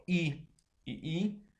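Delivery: i y i, each vowel said slowly and apart; slow teacher demo of the vowels i y i; spelled vowels. Shown as a demonstration. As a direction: sound out i y i one vowel at a time. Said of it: "Si estas son iguales, por ejemplo".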